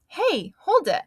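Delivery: high, eager burst of an idea; light up with the idea; real excited. Acted. Girl discovering her new clown name, excited. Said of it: "real excited".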